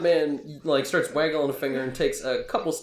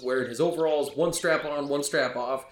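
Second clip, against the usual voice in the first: usual speaking voice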